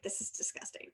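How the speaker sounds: a hushed, horrified aside; mock whisper. Host imitates her cohost seeing the ugly picture, whispered.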